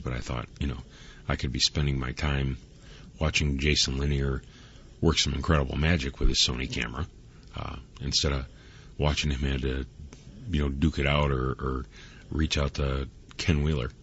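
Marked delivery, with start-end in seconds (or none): none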